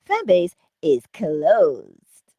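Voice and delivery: loud, slow sign-off with "closed" stretched out; drawn-out announcement. Real, not acted. Host shutting the fan base stream, drawn-out announcement. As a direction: announce it big, dragging out "closed".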